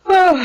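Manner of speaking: sudden high loud yelp; startled yelp. Acted. Mosquito-bitten man jolting awake, yelping high. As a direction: jolt awake with a short cry.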